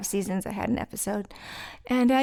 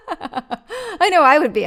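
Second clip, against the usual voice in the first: said through a real laugh, slow and loud; giggling as she admits it; laughing while talking, drawn out; 9 semitones higher, 8 dB louder, slower than usual. Real, not acted. Laughing at herself.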